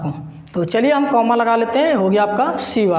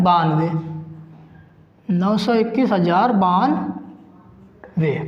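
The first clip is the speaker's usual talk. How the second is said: slow and deliberate; 3 semitones lower, slower than usual. Shown as a demonstration. Teacher reading the full number to pupils, slow and deliberate. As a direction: read the number slowly, spacing out each part. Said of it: "अब आपको समझा दे रहा हूँ फिर से".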